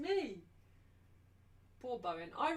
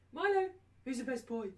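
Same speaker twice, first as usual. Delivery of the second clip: much higher, louder sing-song praise; dog praise voice; 7 semitones higher, 4 dB louder than usual. Real, not acted. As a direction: hype the puppy up.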